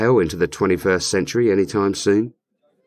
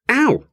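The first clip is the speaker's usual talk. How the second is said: sudden yelp of pain; 13 semitones higher, 3 dB louder than usual. Real, not acted. Hurt by demon.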